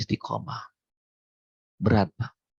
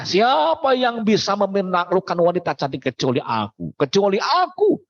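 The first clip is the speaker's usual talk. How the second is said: mock boastful yell; 7 semitones higher than usual. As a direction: crow it from the rooftop, proud and pitched up. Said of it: "Dia teriak".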